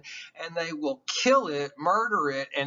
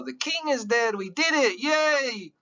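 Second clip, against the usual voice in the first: much higher, gleeful cheer ending in a squeal; mock celebration; 9 semitones higher than usual. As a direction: cheer like a crowd that just won. Crowd celebrating the father's death.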